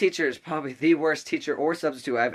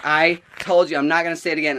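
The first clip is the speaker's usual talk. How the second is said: loud, raised retold scolding; stern refusal; 4 semitones higher, 7 dB louder than usual. Teacher refusing the student once more, stern and loud. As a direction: lay down the law as the fed-up teacher.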